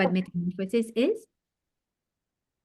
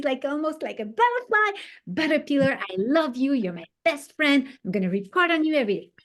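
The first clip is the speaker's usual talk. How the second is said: put-on excited child; 6 semitones higher than usual. Child adoring his caterpillar.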